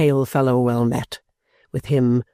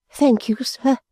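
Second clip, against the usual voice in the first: tearful thanks; 11 semitones higher, slower than usual. A narrator's reading. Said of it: "bursting into tears".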